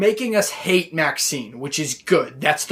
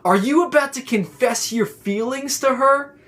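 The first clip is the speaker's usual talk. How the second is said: high-pitched mock incredulity; imitated scornful question; mocking taunt; 7 semitones higher than usual. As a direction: tease him in disbelief, voice pitched up. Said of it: "He starts laughing and he's like".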